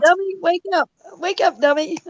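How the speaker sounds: high, loud mock scolding wake-up call; scolding God. God knocking her around to teach her, high mock scolding. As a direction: shake her awake like a teasing scold.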